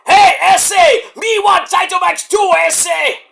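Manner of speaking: high, loud broken-English shout, slow; mock luchador shout. Laredo Kid challenging for a title, shouting. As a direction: bellow it like a dim brute. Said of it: "he says".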